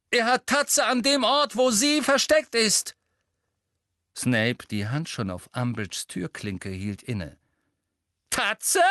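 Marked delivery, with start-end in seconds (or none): 0.1-3.0 sehr hoch und laut gerufen; rufend. A narrator's reading. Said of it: "rief er". 8.3-8.8 hohe, schreiende Rückfrage; schreiend. A narrator's reading. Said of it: "schrie Professor Umbridge".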